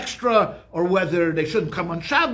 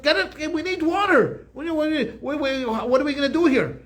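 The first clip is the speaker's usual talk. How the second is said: high, pleading cry for water; mock desperate plea; 1 imitating desperate crowd; 7 semitones higher than usual. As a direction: voice the thirsty crowd pleading, pitched up. People screaming at Moses for water.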